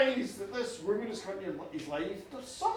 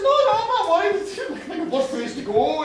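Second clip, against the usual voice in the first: loud, high-pitched protest, comically rattled; flustered denial; 5 semitones higher, 8 dB louder than usual. Acted. Farmer denying he fears the goddess, flustered protest. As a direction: protest far too much, pitched up and loud.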